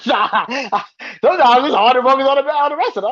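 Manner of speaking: laughing. Real, not acted. Laughing about distraction.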